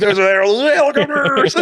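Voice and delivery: very high, drawn-out squeal through laughter; hammed-up high battle cry, stretched out; mock battle excitement. Man play-acting a war reenactment, squealing with mock excitement.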